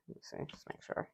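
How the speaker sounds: quick whisper to herself. Real, not acted. Checking the colours, quickly whispering to herself. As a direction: whisper it quickly under your breath.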